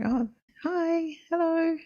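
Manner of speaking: high, bright mock child hello; cheery kid greeting. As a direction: chirp it like a friendly kid. Imitating kids' friendly greeting.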